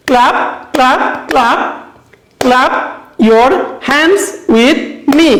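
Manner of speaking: slow word-by-word beat on "clap", "hands", "me"; stressed words clapped out. Shown as a demonstration. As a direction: punch "clap", "hands" and "me", a gap between words. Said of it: "stress দিতে হবে এই ওয়ার্ডগুলোতে".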